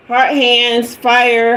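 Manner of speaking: ordinary delivery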